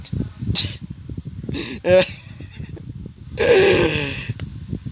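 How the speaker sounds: breathless laugh with high squeaks; long, helpless wheeze of laughter; wheezing laugh. Real, not acted. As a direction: crack up at your own joke, wheezing. Cracks up at own joke.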